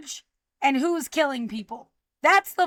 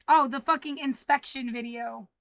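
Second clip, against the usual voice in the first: ordinary talk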